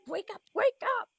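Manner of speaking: high, frantic plea to wake up; frantic pleading. Acted. Player voicing her character begging herself to wake, frantic. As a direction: cry out to herself in panic.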